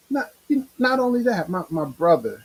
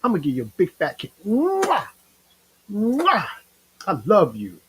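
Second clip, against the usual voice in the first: high, loving coo with a big smacking kiss; affectionate greeting; 7 semitones higher than usual. Shown as a demonstration. Shows viewers how to greet loved ones, affectionately. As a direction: gush affection at them, kisses and all. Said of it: "Try that for a change".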